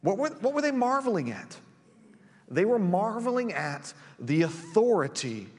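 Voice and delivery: ordinary talk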